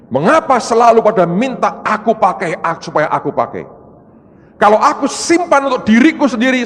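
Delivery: loud, high, reproachful divine voice; God's changed tone. Retold or imitated. God answering the preacher in a changed, reproachful tone. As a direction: rebuke the children who only want to be used. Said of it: "nada bicaranya berubah".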